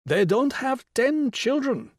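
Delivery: very high, slow, lilting woman's voice; high sing-song explanation; Mrs Rao's voice. A narrator's reading. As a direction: pitch it right up and explain slowly. Narrator voices Mrs Rao explaining.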